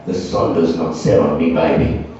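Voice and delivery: low, slow, self-assured lover's voice; cocky lover. Acted. Boasting lover on top in bed, low slow cocky voice. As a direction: drop it low and draw it out, cocksure. Mock boast during lovemaking.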